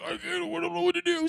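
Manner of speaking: mock panic about the line; high whiny panic; panicked. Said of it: "And I was like".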